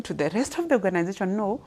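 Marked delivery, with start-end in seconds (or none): none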